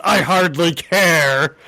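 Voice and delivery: mock crime boss indifference; bored Kingpin. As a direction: brush him off like nothing. Kingpin dismissing Shang-Chi.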